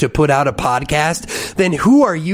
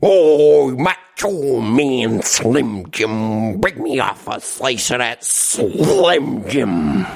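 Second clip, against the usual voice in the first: much lower, very slow wrestler growl; Macho Man impression; 4 semitones lower, much slower than usual. Friend doing Macho Man at the GameCube, low slow ham. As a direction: ham up the Slim Jim wrestler ad. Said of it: "turn into friggin' Macho Man Randy Savage".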